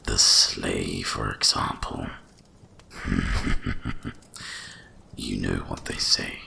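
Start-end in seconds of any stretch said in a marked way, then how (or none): 2.9-4.7 breathy, voiceless chuckle; amused dragon chuckle. Acted. Dragon amused by the sleigh legend, breathy chuckle. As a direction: chuckle to yourself at a secret.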